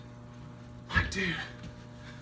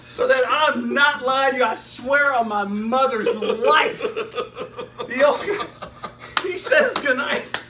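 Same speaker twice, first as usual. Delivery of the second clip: ordinary delivery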